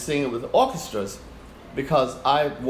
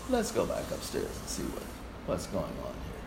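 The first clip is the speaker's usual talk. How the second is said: much softer hushed whisper; 8 semitones lower, 11 dB quieter than usual. Retold or imitated. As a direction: lean in and whisper calmly. Fred quietly taking charge.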